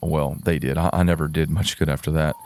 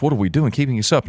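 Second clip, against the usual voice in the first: mild mock yell, pitched up; retold angry yelling; 6 semitones higher, faster than usual. Lord scolding the children in a huff, mildly angry. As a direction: lightly imitate an angry scolding. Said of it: "angry, yelling at us".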